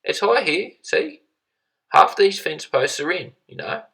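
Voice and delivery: put-on a cheery English neighbour voice, English accent lifted high; chirpy English accent. A narrator's reading. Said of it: "he said in a chirpy accent".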